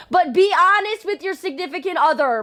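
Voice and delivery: loud high pleading. Real, not acted. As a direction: beg them, rising in pitch. Pleading with chat about honesty.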